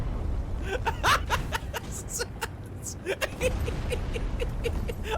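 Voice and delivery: high, wheezy uncontrollable laughter; can't stop laughing; breathless wheezing laugh. Real, not acted.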